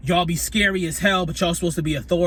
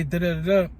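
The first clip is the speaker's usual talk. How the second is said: ordinary talk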